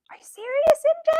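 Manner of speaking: gasp, then a high, breathy whisper; shocked gasp. Real, not acted. Reacting to a viewer's news in a shocked gasp. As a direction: react in disbelief, breath caught.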